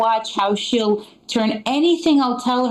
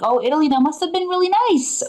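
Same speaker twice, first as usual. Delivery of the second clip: lightly voiced gushing, pitched higher; mock-sweet imitation of people; 7 semitones higher than usual. People imagining a lovely Italy trip, lightly gushing. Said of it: "a lot of people are like".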